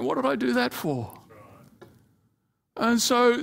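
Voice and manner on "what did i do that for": higher, softer voice of regret; regretful self-talk. Retold or imitated. Pastor voices someone regretting yelling at a loved one, rueful. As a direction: ask it like you wish you hadn't done it. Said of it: "No, you go away going".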